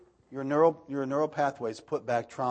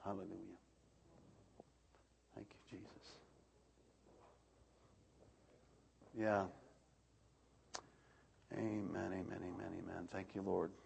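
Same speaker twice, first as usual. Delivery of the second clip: much softer, low prayerful amens; hushed worship murmur; 5 semitones lower, 14 dB quieter than usual. Real, not acted. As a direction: breathe the praises under your voice. Quiet murmured worship aside.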